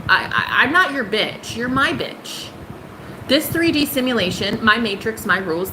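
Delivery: normal talking voice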